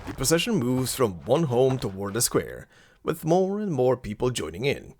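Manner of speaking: ordinary talk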